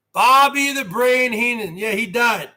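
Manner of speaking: ordinary talk, nothing marked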